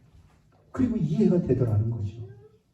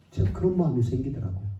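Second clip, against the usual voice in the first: normal talking voice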